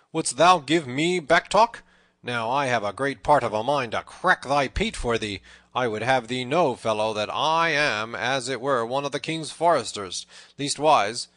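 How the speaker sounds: loud, indignant threat; great loud voice. A narrator's reading. Little John threatens the saucy tanner, in a great loud voice. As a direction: boom it out to cow him. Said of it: "in a great loud voice".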